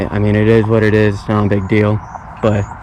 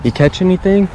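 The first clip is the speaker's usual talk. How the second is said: friendly neighbor greeting; 7 semitones higher than usual. Retold or imitated. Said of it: "waved at me and said".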